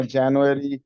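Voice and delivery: जनवरी as Jan-yoo-ary in American style; American accent; American pronunciation. Shown as a demonstration. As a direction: model the months the American way. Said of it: "we learned कि हमने उनको कैसे कहना है".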